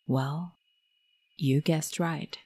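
ordinary delivery, nothing marked

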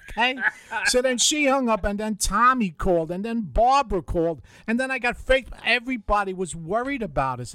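normal talking voice

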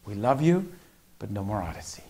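soft, lowering loving verdict; retold gentle parent laying down a rule; quietly apologetic but settled; tender firmness. Father tenderly sets a rule.

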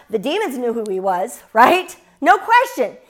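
usual speaking voice

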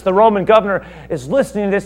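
usual speaking voice

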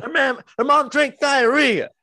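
very high, loud blurting; mock outraged yell. Sketch diner blurting about her mom, mock outraged yell. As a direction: yell it at the top of your pitch. Quotes sketch character's outburst.

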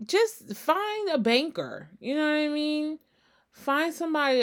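sly advice. Real, not acted. High, slow advice.

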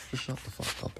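whispered, dropping to a hiss; hissed dismissal. Real, not acted. Host silences hypocrisy accusers, whispered hiss. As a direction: breathe it out with contempt. Ranting at online critics.

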